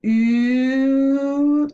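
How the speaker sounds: "oo" held high and loud; long U sound. Shown as a demonstration. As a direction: sustain a high, rising "oo". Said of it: "That's the long U sound".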